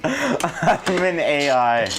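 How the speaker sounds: slow, high, laughing tease; mock the slip through laughter, drawn out; teasing mimicry.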